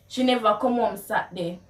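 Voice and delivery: quick Jamaican Patois delivery; fast Patois, NEVER carried. Shown as a demonstration. Asking a friend where she was, in Jamaican Patois. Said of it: "how you would translate the words".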